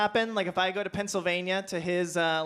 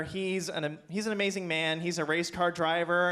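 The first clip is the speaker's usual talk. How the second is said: ordinary delivery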